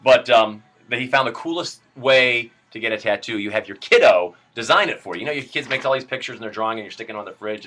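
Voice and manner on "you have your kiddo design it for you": ordinary talk, nothing marked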